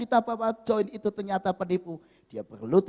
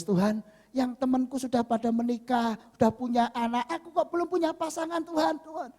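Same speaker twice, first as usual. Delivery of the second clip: mild mock-crying complaint, rising higher; tearful pleading; 6 semitones higher than usual. Single woman complaining to God, mildly tearful. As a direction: plead plaintively, lightly imitating tears. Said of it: "terus dia nangis".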